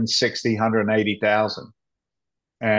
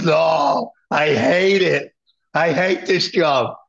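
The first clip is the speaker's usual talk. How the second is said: higher, drawn-out retold complaint; acted exasperation; 5 semitones higher, much slower than usual. Fire chief confessing job misery to old buddy, acted exasperation. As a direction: act out a friend fed up with work.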